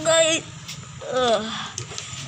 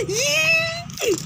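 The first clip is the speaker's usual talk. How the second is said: shriek; 14 semitones higher than usual. Acted. Character shrieks at broken tank.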